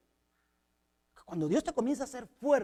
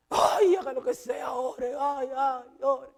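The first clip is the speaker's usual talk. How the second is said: a suffering patient crying out, fed up: just pray; woman in pain; 3 semitones higher, slower than usual. Retold or imitated. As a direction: groan it out like a woman in agony, begging. Woman in pain answers impatiently. (paciente adolorida que grita desesperada)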